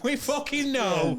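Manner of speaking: high, slow, drawn-out retort; hammed-up fed-up reply; mock exasperation. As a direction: stretch it out in comic despair. Acted retort repeated with swearing.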